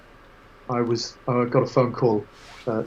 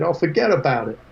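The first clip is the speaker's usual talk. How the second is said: higher-pitched mock New York accent; 4 semitones higher than usual. Photographer replies jokingly to the singer, mock New York accent. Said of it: "So in a New York accent".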